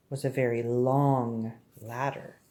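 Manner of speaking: slow, low, stretched-out storytelling; drawn-out emphasis. A narrator's reading. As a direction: draw out "long" to show the ladder's length. Stretching the long ladder.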